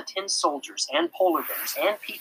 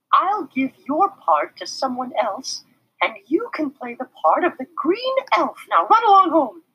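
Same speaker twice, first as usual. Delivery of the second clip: smiling; 12 semitones higher, 5 dB louder than usual. A narrator's reading. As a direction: gently send him home, smiling. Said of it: "Then she smiled".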